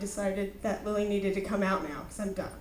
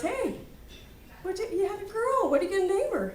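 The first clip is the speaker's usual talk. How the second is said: high-pitched imitated gushing; excited mother-in-law; 10 semitones higher than usual. Mother-in-law greeting new mom, high excited imitation. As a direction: pitch it way up, like an eager visiting relative.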